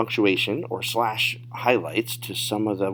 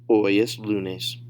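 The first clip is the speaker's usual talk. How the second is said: connected "Hoy es lunes"; advanced-level demo without long gaps; natural speed; much slower than usual. Shown as a demonstration. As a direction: run the answer together at native pace. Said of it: "say them at natural speed".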